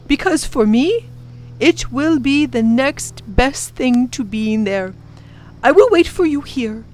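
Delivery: put-on professor reassuring the children he'll wait, tenderly.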